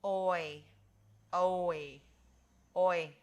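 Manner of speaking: "oi" as long O gliding to ee; OI sound. Shown as a demonstration. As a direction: say each "oi" loud and separately. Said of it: "See if you can follow me".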